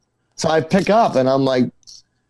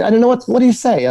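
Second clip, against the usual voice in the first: flustered; 11 semitones higher, 3 dB louder, faster than usual. Retold or imitated. Retelling being lost for words on stage.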